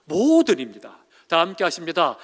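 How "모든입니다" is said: 모든입니다 said loud and slow; stress on 모든. Shown as a demonstration. Preacher stressing to the congregation that comfort is 모든, loud and slow. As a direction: lean hard on 모든, drawing it out. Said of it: "이건 위로해주고 이건 위로해주지 않고".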